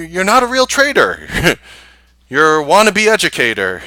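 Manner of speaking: mocking loud accusation; loud jab. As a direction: call them out to their face. Venting thoughts at bad teachers.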